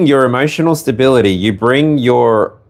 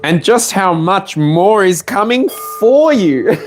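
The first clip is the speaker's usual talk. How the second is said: laughing through the words, pitched up; 8 semitones higher than usual. Real, not acted. Tarot reader to Taurus, laughing and excited. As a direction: giggle as the good news comes.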